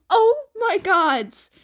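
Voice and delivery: high, loud, slow gasp of shock; surprised exclamation. Acted. Annabeth realising she approached a stranger, loud shocked cry. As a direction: blurt it out, caught off guard. Annabeth startled by mistaken identity.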